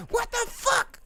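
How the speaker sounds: very high squeaked exclamation; startled disbelief. Real, not acted. Streamer thrown mid-sentence, high-pitched astonishment. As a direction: break off into high, squeaky surprise.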